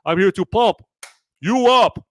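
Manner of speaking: high, loud pumped-up Austrian bodybuilder voice; Hans and Franz. Retold or imitated. Mocking the Hans and Franz sketch, Austrian bodybuilder voice. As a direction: boom it out like a gym muscleman, Austrian accent. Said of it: "It's not the Hans and Franz".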